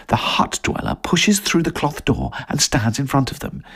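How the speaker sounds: ordinary delivery, nothing marked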